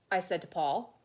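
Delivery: put-on lively read-aloud to children; storybook reading. A narrator's reading. Teacher reading a picture book aloud to her class, animated. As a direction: tell it like a kid's picture book.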